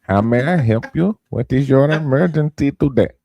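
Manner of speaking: put-on a light Indian accent, as a call center agent. Playing a 911 operator taking a call, with a light Indian accent. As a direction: greet the caller in a mild Indian accent. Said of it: "Why'd you pick the Indian accent".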